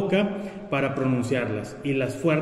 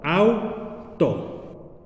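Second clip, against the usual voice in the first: "auto" slowly, "au" held as one syllable, then "to"; split into syllables. Shown as a demonstration. Teacher joins the vowels to form the diphthong, "auto" as au-to. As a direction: keep "au" together, pause, add "to". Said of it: "las juntamos para formar el diptongo".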